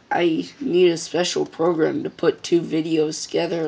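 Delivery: ordinary talk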